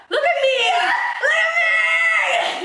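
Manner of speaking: hammed-up squealing mock; mocking shout. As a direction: shriek it like a show-off. Mocking shrill call.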